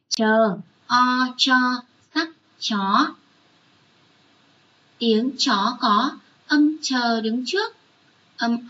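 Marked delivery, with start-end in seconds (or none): 0.1-3.1 slow syllable-by-syllable spelling of chó; spelling aloud. Shown as a demonstration. Teacher spelling chó for the class, syllable by syllable. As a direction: spell out chó as Chờ, o, cho, sắc, chó, letter by letter. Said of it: "Các con nghe cô đánh vần nhé".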